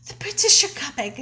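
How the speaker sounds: high, hammed-up breathless messenger; mock out of breath. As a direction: act winded from running back with news. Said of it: "ran back all out of breath".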